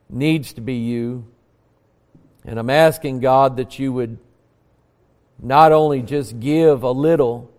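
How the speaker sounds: subdued, low-pitched praying voice; earnest prayer. Real, not acted. Preacher asking God for help before preaching, humble prayer. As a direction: pray humbly, in short pleading phrases.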